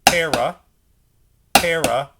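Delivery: Para, accented start. Shown as a demonstration. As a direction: call the accent on PA. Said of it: "Accented left hand".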